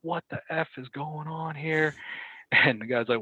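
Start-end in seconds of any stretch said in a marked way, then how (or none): 0.0-1.9 imitated angry disbelief, pitched up; retold pissed-off sergeant. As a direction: act out an irate officer's demand. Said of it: "Like, he was pissed. He came in just like".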